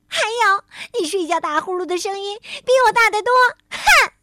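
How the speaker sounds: high, fast, loud accusation; upset retort. A narrator's reading. Tiaotiao accuses friend of snoring, upset. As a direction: hit back, ending in a huff. Quarrel while in tears.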